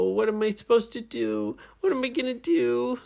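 high, fretful character voice; worried heroine. A narrator's reading. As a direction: fret helplessly in a high voice. Nora worries in the story.

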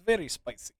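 very spicy in a hammed-up Russian accent, soft; mock Russian accent. Showing his friend how to say it, mock Russian accent. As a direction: say it like a joking Russian. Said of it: "You have to say it in Russian accent".

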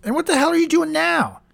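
high-pitched aghast questioning; alarmed disbelief. Acted. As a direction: question him in mounting shock. Host catches guest with drugs.

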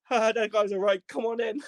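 high, jovial welcome with a laugh; amused approval. Retold or imitated. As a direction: wave him in, tickled by the joke. Guard amused, lets him in.

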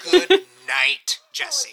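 high, loud, laughing sign-off; laughing goodnight. Acted. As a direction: cut the gossip short with an amused goodnight. Teasingly ends talk after innuendo.

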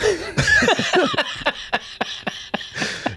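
long high-pitched laugh; real high laughter. Real, not acted. Laughing at own story.

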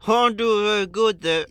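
loud, high, comic character voice; Muriel. Acted. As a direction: answer loudly in a silly put-on voice. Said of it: "You are Muriel".

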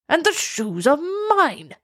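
lightly voiced king bellowing over his stolen shoes; mild thunder. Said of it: "he thundered".

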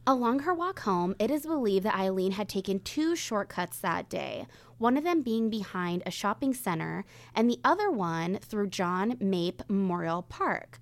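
measured true crime storytelling; suspenseful crime show narrator. Acted. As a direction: tell the case calmly, with weight. Reads the day of disappearance.

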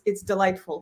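ordinary talk, nothing marked

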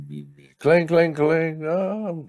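high mock alarm clangs; mocking re-enactment. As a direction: parody the badly timed alarm in a high voice. Mocking the alarm interrupting a kiss.

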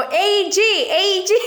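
high raspy shout through laughter; a laughing bullhorn holler. Retold or imitated. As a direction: bellow the team name across the room. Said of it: "when I scream, like, it's like a bullhorn".

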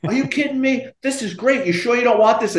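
imitating a delighted man, pitched high; high, eager retold disbelief at a gift; thrilled. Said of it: "you would have thought I handed him".

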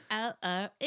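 mock Cockney vowels; Cockney accent. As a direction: practise a hammed-up Cockney to yourself. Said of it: "I walk around my house and I'm like".

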